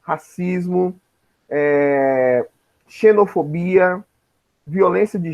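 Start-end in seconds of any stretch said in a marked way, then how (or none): none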